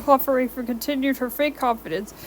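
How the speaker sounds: ordinary delivery, nothing marked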